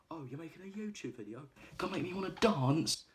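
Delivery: high, rising taunt that gets loud; mock taunt. Thug taunting Big Dave before the kidnap: high, loud jeer. Mocking taunt to captured man.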